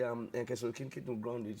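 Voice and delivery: ordinary delivery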